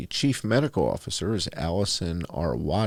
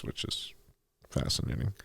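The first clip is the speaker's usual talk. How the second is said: hushed mock wonder; sarcastic whisper; 7 semitones lower, 6 dB quieter than usual. Real, not acted. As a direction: drop to a dry whispered aside. Sarcastic aside on touting successes.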